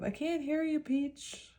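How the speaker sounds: high squeaky cartoon voice, playfully quoted; Finding Nemo quote. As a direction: chirp it like a cartoon fish. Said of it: "Finding Nemo".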